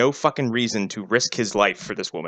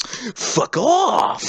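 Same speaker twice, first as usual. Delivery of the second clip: mock punk snarl; 11 semitones higher than usual. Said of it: "And Eric's like".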